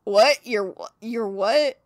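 loud confused questioning; mock baffled. Answering a girl who says cookie, loudly bewildered. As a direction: act baffled and incredulous. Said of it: "And I'm like".

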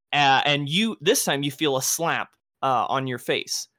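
ordinary talk, nothing marked

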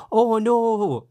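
put-on Welsh accent. Welsh character in Fireman Sam calling to Sam, mock Welsh accent. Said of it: "he's like".

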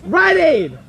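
high, loud teasing call; playful hype shout; excited shout. Real, not acted. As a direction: yell it out to egg him on. Mocking friend's Rite Aid rant.

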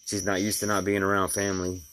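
normal talking voice

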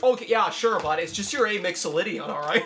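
laid-back Canadian dude; Canadian accent. Retold or imitated. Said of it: "so he'd be like".

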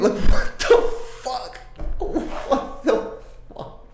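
breathless, laughing high squeak of disbelief. Real, not acted. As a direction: laugh it out, barely voiced. Laughing disbelief after lyrics.

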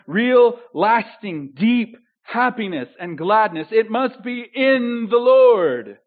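loud, rising proclamation; full-force preaching. Acted. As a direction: build the praise like a preacher in full flight.

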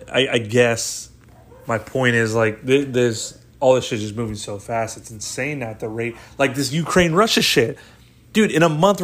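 ordinary talk

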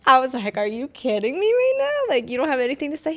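loud, high disbelieving "What?" then rapid protest; half-shouted, acting out her shock; incredulous outburst. Retold disbelief at husband's reaction.

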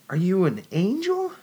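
high, breathless wonder rising; wondering young woman. A narrator's reading. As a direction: pile up the questions in awe. Jennifer awed.

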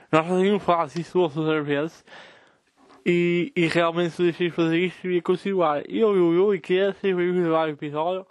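talking around a pen in the mouth; muffled words with a pen between teeth. Shown as a demonstration. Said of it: "meter uma caneta ou um lápis na boca e começar a falar assim".

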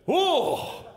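high loud gasped oh; shocked oh. Retold or imitated. Family in the lounge reacting with a loud high oh. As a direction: gasp in shock at the screen. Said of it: "everybody in the lounge just goes".

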